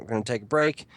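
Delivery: ordinary delivery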